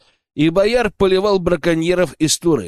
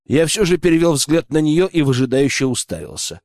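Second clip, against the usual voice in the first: ordinary delivery, nothing marked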